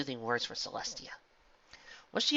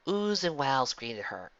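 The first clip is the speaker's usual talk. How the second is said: plain reading voice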